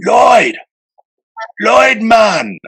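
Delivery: calls Lloyd awake across the hotel room, loud and high; calling out. Retold or imitated. Wake him with a loud high call. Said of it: "he goes".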